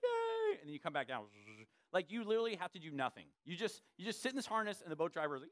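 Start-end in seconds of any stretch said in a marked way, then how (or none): 0.0-0.6 high squealing yay; gleeful cheer. Retold or imitated. Pastor mimics kid's joyful cheer, high and squealing. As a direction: cheer like a kid lifting off.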